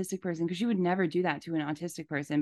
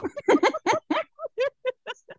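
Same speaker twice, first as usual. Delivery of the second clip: high laugh. Real, not acted. Hearing the "vile" message.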